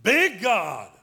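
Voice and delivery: BIG bellowed high and loud; huge exultant shout. Real, not acted. Preacher magnifying God before congregation, exultant shout. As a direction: shout it big, falling at the end. Big versus little, acted by voice.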